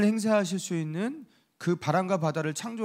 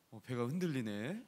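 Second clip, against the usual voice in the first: soft, low, unbothered murmur; unruffled, nonchalant; 5 semitones lower, 10 dB quieter than usual. Acted. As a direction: play it as if nothing is wrong, quietly. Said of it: "담담하게 그냥 이렇게".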